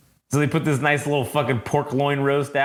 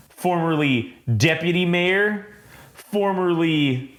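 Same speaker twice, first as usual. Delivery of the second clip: slow, higher, drawn-out mock questions; pointed questioning; 5 semitones higher, slower than usual. She lists the guest's former jobs, pointed questioning. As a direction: stretch out each title, pointedly.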